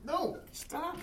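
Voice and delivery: squealing high-pitched cry. Real, not acted. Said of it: "That was a bit of a girly voice".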